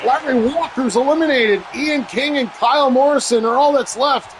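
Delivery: excited shouting commentator. Acted. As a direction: yell it like the crowd's on its feet. Elimination called.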